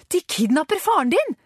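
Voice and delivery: high-pitched cry of alarm; alarmed shout. A narrator's reading. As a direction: call out the warning while running. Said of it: "ropte Karen idet hun løp".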